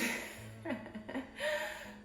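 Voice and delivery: a soft airy giggle of delight; quick breathy laugh at her own excitement. Real, not acted. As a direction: giggle under your breath, thrilled. Giddy at starting her channel.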